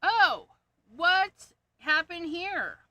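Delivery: sudden high surprised yelp; startled by a mistake; oh no, what happened. Real, not acted. Finds a marker in wrong spot.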